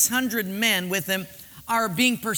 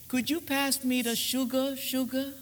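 romantic husband; 2 semitones higher, 6 dB quieter than usual. Acted.

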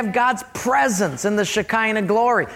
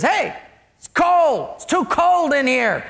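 higher-pitched acted complaint; irate customer; 6 semitones higher than usual. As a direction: call the waiter out, mock-annoyed. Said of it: "he was just irate".

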